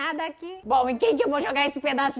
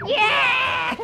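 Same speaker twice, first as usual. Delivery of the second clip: high, loud whoop and laugh; victory whoop; 11 semitones higher, 5 dB louder than usual. Real, not acted. After escaping the chasers, high loud victory whoop. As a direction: cheer your escape at the top of your voice.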